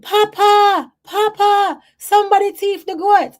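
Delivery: high, loud alarmed call; frantic high shout from the yard; grandmother calling out in alarm. Retold or imitated. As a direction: cry out to Papa that the goats are gone. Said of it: "she was saying".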